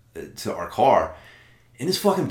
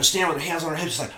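normal talking voice

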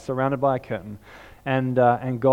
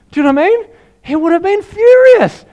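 excited; 12 dB louder than usual. Acted. Still fired up after the shout.

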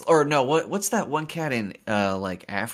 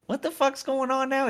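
mock bewildered; 13 semitones higher than usual. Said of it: "I was like".

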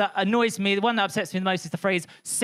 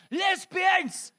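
mock yell; 11 semitones higher than usual. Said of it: "he yells out of his car".

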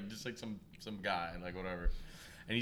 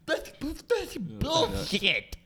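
high, slow mocking grumble; mock the customer's complaint; imitating a complainer; 11 semitones higher, much slower than usual. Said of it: "The second he leaves".

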